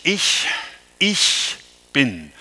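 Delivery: "Ich, ich bin" with the first "Ich" set apart and stressed, then repeated; doubled stressed I. Shown as a demonstration. Preacher quoting Jesus to the congregation, "Ich, ich bin" with the doubled Ich stressed. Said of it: "dieses doppelte, betonte Ich".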